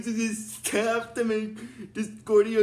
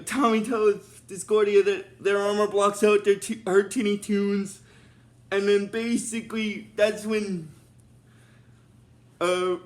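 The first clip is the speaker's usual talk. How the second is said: ordinary talk, nothing marked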